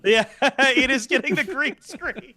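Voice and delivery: laughing through the words; giggling while talking. Real, not acted. Laughing at blue tongue.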